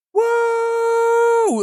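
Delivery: long, high woo; drawn-out high hype whoop; high whooping cheer. Real, not acted. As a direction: whoop it up for the stream. Cheering the stream.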